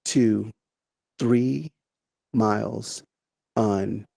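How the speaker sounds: one word at a time, long pauses between; painfully slow. Shown as a demonstration. Speaker teasing the audience with slowed-down talk, painfully slow. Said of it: "You're talking so slow".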